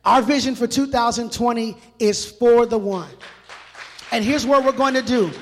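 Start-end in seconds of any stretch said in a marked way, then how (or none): none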